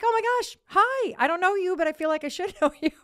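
high, loud squeal tumbling into a laugh; squealed greeting, speeding up at the end; excited gushing. Retold or imitated. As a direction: gush back at a new friend, reenacted. Acting out excited greeting back.

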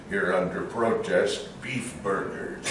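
low, slow, soft grumbling Orson; a hint of fed-up Orson in a session; grumpy Orson. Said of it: "[name] does the one where he went crazy".

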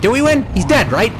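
usual speaking voice

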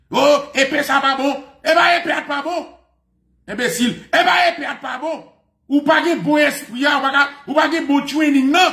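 high-pitched, agitated preacher's rant; heated preaching. Real, not acted. Preaching at full force. (prèch cho, vwa wo)

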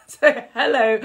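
hello broken up by real laughter; laughing. Real, not acted. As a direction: giggle through the greeting. Laughs at her own remark.

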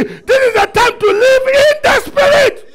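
very high, full-force preaching scream; shouted like you mean it. Shown as a demonstration. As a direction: scream the declaration with total conviction. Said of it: "You are not saying as if you mean it".

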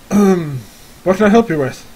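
a hint of an older gentleman. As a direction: greet visitors as a lightly voiced old man. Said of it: "kind of like an older gentleman".

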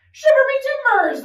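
high, loud pirate oath; pirate exclamation. Acted. Pirate reacts to learning he is lost, loud exclamation. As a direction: blurt it like a startled storybook pirate. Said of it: "the pirate said".

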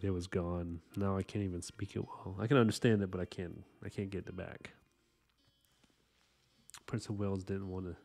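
ordinary talk, nothing marked

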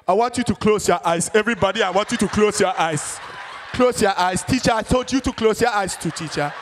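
put-on commanding Ghanaian accent, like Lion King; solemn, grand Ghanaian prayer leader; Kwesi from Ghana. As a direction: order the room in a Ghanaian accent. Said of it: "why Kwesi sounds like Lion King".

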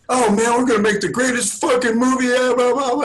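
playing his buddy gushing at the bar; hyped-up Ed Burns. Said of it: "And he's like".

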